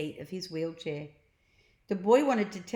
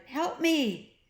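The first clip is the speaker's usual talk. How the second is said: high, louder shout for Slime; 9 semitones higher, 5 dB louder than usual. A narrator's reading. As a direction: call out while falling. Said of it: "he shouted".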